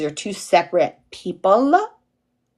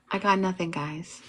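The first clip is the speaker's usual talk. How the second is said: deflated mumble; 9 dB quieter than usual. Retold or imitated. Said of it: "And I would go".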